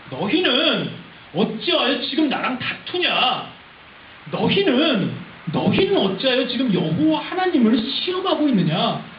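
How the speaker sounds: loud, forceful scolding; stern rebuke. Acted. Angry Moses scolds the thirsty people, forceful and stern. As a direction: rebuke them hard, stretching the accusation. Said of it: "굉장히 강하게 꾸짖고".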